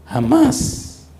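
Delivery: high, loud cry of jamás; jamás rising high and loud; emphatic cry. Acted. Emphatic repetition of never.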